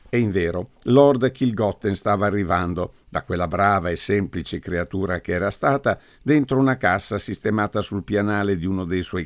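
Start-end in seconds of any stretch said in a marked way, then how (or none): none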